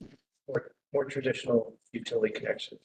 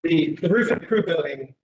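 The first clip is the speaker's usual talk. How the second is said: louder and higher, projecting; speaking up; 5 semitones higher, 8 dB louder than usual. Real, not acted. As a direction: project so the far listener hears. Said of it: "Speak up a little bit more".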